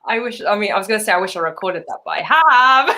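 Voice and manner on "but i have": laughing, loud and high; gleeful, pitched-up admission with a laugh; gleeful boast. Real, not acted. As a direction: crow it out, breaking into laughter.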